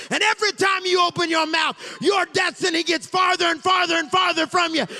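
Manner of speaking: high, loud, roaring sermon cadence; preaching at full force. Acted. Preacher thundering the Bible story to his church, full force. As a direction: pound the story out to the congregation.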